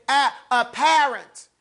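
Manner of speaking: slow "apparent", breaking off the first sound first; inflection on apparent. Shown as a demonstration. Teacher explains inflection to class, slow emphatic "apparent". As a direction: spell out the stress in "apparent" slowly. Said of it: "The inflection is knowing to say apparent".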